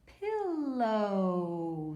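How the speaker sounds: "Pillow" stretched on the final O; drawn-out long O. Shown as a demonstration. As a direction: sound out "Pillow" slowly, ending as in snow. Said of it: "There's another O sound".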